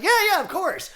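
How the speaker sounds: high, loud eager yeah yeah; eager agreement. Retold or imitated. Girlfriend answering Garry Shandling, high eager agreement.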